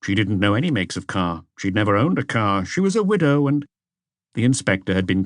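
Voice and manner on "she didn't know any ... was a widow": usual speaking voice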